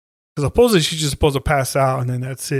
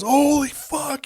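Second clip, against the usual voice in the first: high, slow, stunned holy fuck; stunned gasp; 12 semitones higher, slower than usual. Retold or imitated. Husband sees his wife's head hit the statue, stunned and high. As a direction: hang on each word in shock.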